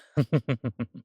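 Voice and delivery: rippling, self-satisfied chuckle; villainous chuckle. Acted. Vampire anticipating an eventful night, villainous chuckle. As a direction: chuckle with dark relish.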